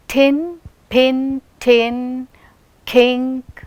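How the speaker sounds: "thin, pin, tin, kink", each opening with a puff of air; breathy release on the first consonant of "pin", "tin", "kink"; aspirated consonants. Shown as a demonstration. Teacher lists aspiration examples, first consonants of "pin, tin, kink" aspirated. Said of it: "it is said with aspiration".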